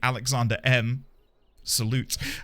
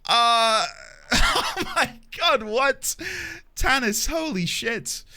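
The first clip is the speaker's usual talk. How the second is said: real shocked laughter; 10 semitones higher, much slower than usual. Real, not acted. Shocked by a surprise raid.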